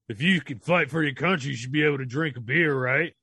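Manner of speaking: loud, pumped-up promo rant; wrestling promo. Pretend. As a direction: bellow it like a ring promo. Mock patriotic rant.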